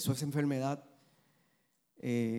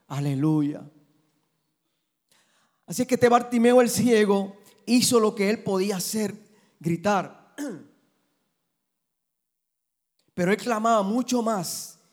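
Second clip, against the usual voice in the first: loud, higher fiery preaching; full-force preaching; 8 semitones higher, 10 dB louder than usual. Acted. Pastor urging his congregation about Bartimeus, loud fiery preaching. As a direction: preach it with fire, building.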